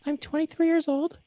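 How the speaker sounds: very high chirpy voice; young high voice. Shown as a demonstration. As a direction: keep the pitch way up. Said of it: "this is how you sound young".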